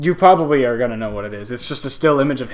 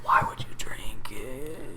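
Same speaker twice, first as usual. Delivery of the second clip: whispering; 2 semitones lower, 10 dB quieter, slower than usual. Real, not acted. Whispered aside.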